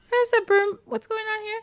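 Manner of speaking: put-on high, puzzled girl voice; confused girl. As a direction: sound baffled and high. Vasilia confused by moving broom.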